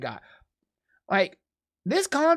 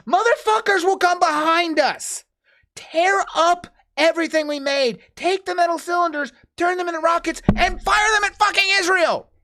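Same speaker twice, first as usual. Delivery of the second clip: higher, louder furious outburst; angry rant; 10 semitones higher, 4 dB louder than usual. Real, not acted. Venting to co-host about rockets, real angry yelling. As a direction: rail against them, really worked up. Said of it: "I'm angry".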